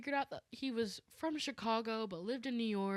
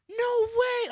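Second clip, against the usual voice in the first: high, loud, thrilled cry of disbelief; excited exclamation; 11 dB louder than usual. Retold or imitated. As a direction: shriek it like meeting a star. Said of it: "And we were just like".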